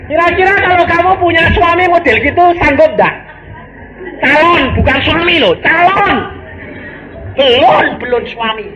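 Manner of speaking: high, loud preacher's challenge to the crowd; full-force preaching. Acted. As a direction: pitch it high and loud at the congregation.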